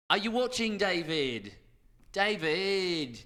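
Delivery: lightly voiced taunting catchphrase, a bit high; player's catchphrase. Caster quoting a New Zealand player's catchphrase, lightly voiced. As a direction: call out to David, teasing and drawn out. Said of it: "his catchphrase was".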